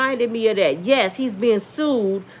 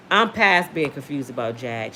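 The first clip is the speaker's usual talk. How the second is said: ordinary delivery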